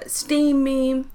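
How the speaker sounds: ordinary talk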